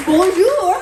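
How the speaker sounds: high, loud mock French greeting; french chef. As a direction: belt it like a cartoon French chef. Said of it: "That's what it sounds like".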